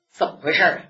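plain reading voice

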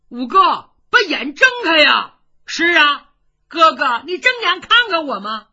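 loud, high pleading cry to open his eyes; pleading call. Acted. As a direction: call out to him, begging. Generals plead with Shan Xiongxin.

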